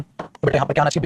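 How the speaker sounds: ordinary delivery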